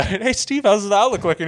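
high, loud mock-cheery question; Bill Burr. Friend retelling comedian's bit, asking scientist the outlook, high and loud. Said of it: "He's like, uh".

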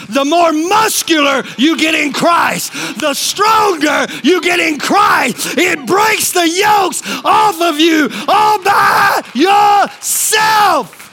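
slow, emphatic build to a high shout; fired-up pulpit crescendo; full-force preaching. Real, not acted. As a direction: climb to a drawn-out climax.

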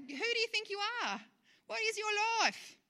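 high-pitched British scolding; British accent. Acted. Preacher voicing James to the planners, scolding British accent. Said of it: "say that in a British accent".

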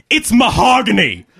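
high-pitched, emphatic correction; emphatic assertion. A narrator's reading. Woman answering whether it's wood, asserting it emphatically. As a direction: assert it proudly, pitched well up. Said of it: "she asserted".

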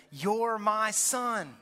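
slow, weighty loving declaration; drawn-out tender affirmation; God's voice. Acted. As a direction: say it like a father blessing his son. Preacher voicing God's affirmation.

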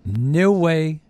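ordinary talk, nothing marked

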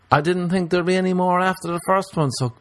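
a lightly voiced desperate romantic vowing forever; mock lovesick man. Comedian voicing a man desperate to marry his second partner, with mock fervour.

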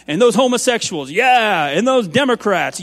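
hammed-up pulpit pounding; mock hellfire preacher. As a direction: play a preacher whipping up the crowd. Parodying a fiery standards preacher.

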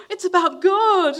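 high, loud, slow gasp-and-exclaim with a laugh; gasping excited woman. Retold or imitated. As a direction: gush it out like an overjoyed churchgoer. Happy smiley woman answers door.

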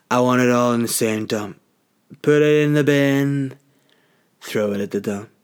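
a hint of a blustering Trump. Comparing his recycling to Trump, mock Trump bluster.

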